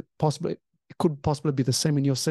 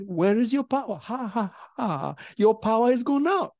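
high, taunting cackle of a spirit; mocking demonic voice; 6 semitones higher than usual. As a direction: jeer at the preacher's lost power. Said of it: "this woman began to speak words and she said".

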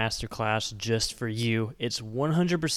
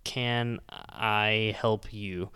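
"In what can I help you?" drawn out word by word; slow word-for-word; 3 semitones lower, much slower than usual. Shown as a demonstration. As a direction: spell out the literal translation slowly. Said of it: "Literally word for word".

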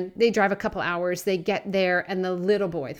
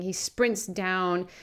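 ordinary delivery

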